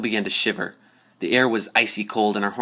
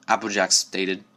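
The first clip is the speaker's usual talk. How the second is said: plain reading voice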